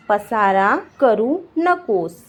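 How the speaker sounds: slow, each word on its own; slow teaching repeat. Shown as a demonstration. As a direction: dictate it to beginners slowly. Teacher repeats slowly for learners. (शब्द-शब्द अलग, धीरे)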